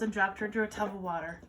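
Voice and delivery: plain reading voice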